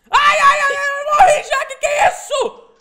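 high, loud panicked wail; panicked shriek. Real, not acted. Player wails after dying in the game, panicked shriek.

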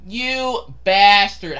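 very slow, stretched words; drawn-out grudging curse. Real, not acted. Player to whoever killed him, drawn-out curse. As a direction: stretch out each word in indignation.

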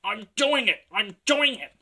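high, loud, effortful exclamation; panted excitement. A narrator's reading. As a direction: call it out mid-climb, thrilled. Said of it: "he panted".

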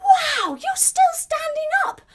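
loud high-pitched amazement; amazed. Pretend. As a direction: squeal with astonishment for the children. Amazed that children still stand.

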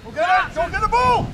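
very high shouted call across the pitch. Real, not acted. Coach on the touchline yelling at players, high call. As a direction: yell to the players to chase it.

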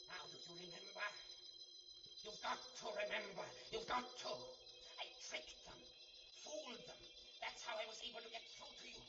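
urgent pleading. Acted. Professor's voice urges John to remember, desperate. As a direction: press him hard to remember. Professor's voice returns to urge memory.

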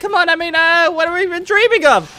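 high-pitched cartoon dub; excitable game character. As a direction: play it high and worked up.

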